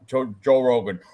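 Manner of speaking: ordinary delivery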